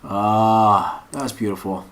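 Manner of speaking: normal talking voice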